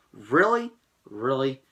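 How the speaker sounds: exasperated really. Real, not acted. Repeated exasperated questions.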